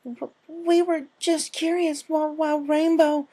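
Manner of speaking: high, shaky-voiced frightened filly; frightened stammer. A narrator's reading. Filly stammering in fear to angry Applejack. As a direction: stammer out a scared excuse.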